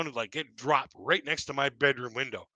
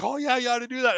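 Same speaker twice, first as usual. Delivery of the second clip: a higher put-on voice for the manager, lightly done; mock manager; 10 semitones higher than usual. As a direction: play the manager egging him on, light touch.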